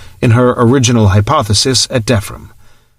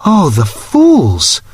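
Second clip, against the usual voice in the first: slow, drawn-out anguish on fools; 5 semitones higher, much slower than usual. A narrator's reading. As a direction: stretch "fools" in dismay. Anguished outburst after whisper.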